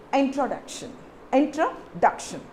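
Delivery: Introduction with the stress on duc. Shown as a demonstration. Teacher models the correct stress for students, stress on duc. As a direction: land the weight on the syllable duc in introduction. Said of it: "So where you give the stress".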